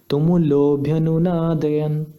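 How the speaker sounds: तुमुलोभ्यनुनादयन with the ओ after ल held long; slow तुमुलोभ्यनुनादयन, stretching the लो; pluta vowel. Shown as a demonstration. Teacher demonstrates the pluta swar, drawn out slowly. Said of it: "मैं फिर से करता हूँ".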